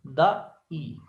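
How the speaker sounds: "दा ई" in two beats with a break before ई; split into syllables. Shown as a demonstration. Teacher pointing out the syllables, "दा ई" said in two parts. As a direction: separate दा from ई in "दा ई". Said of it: "ऐसे देखिए यहां पर".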